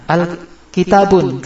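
normal talking voice

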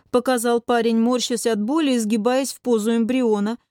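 plain reading voice

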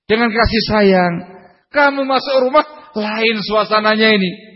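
high, pressing sermon delivery. Acted. Preacher urging husbands to understand their wives, fervent preaching.

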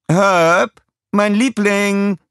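high, loud villainess calling out, drawn out; calling her husband. Acted. Scarlett calls down to her husband Herb, high and loud. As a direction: sing out to your beloved across the hall. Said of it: "rief sie".

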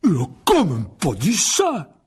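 loud, slow, high outburst of disbelief; scandalised. A narrator's reading. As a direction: bellow it, shocked to the core. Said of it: "l'air scandalisé". (scandalisé, fort et incrédule)

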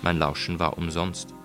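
usual speaking voice

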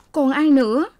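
high, tearful daughter's voice; tearful young woman. A narrator's reading. Daughter tells her parents, high tearful voice. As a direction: let the hurt daughter answer through tears.

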